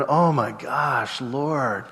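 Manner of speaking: slow, soft plea to the Lord; longing prayer. Acted. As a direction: pray it quietly, wishing hard. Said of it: "here he's going".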